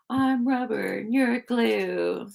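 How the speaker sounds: slow, high singsong taunt; playground taunt. Pretend. Trying out a comeback for heckling kids, singsong taunt. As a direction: draw it out like a kid's tease.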